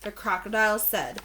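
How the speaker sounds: ordinary delivery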